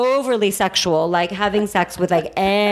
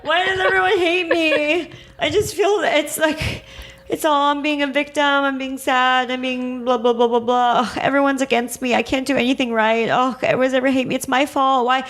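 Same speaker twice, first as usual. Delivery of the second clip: high, whiny mock wail of self-pity; 6 semitones higher than usual. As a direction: play the victim, wailing that everyone hates you. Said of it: "Cancer shadow energy is".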